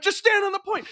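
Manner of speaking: very high, exasperated plea; frantic team callout. Retold or imitated. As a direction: beg a teammate over voice chat in mid-battle. Acted in-game plea, repeated.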